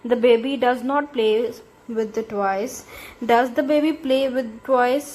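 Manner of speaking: slow, clear reading of each sentence; slow dictation. Shown as a demonstration. Teacher dictating example sentences slowly to the class. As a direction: read it out slowly for students to copy. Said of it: "number टू भी देख लें".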